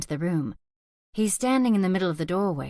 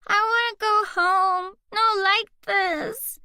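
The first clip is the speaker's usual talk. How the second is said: put-on high, slow whining little girl; a toddler begging to go home; crying child, much higher and slow; upset child; 14 semitones higher, 4 dB louder, slower than usual. A narrator's reading. Child pleads crying in car.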